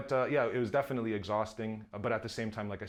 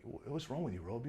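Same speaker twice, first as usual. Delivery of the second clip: attempted South African accent; 2 semitones higher, 6 dB quieter than usual. Imitating the colorist asking after him, shaky South African accent. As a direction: try a hesitant South African accent, quietly. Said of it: "Is that a South African accent?".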